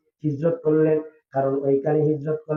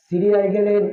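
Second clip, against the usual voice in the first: ordinary talk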